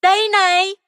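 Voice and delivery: high, loud shout of discovery; a frog shouting he found the sky's edge; loud call. Acted. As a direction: call out eagerly to the fish. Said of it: "kêu to".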